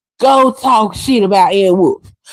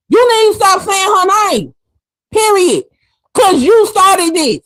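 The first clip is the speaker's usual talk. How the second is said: raised, high-pitched callout; angry rant; 8 semitones higher than usual. Real, not acted. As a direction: tell her off hard. Ranting at Layla.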